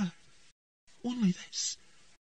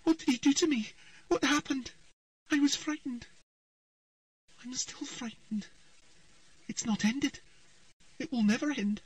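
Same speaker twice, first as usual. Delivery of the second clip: high, rising panic; a child lost in a nightmare, pleading; frightened girl. A narrator's reading. Ciri's frightened trance speech.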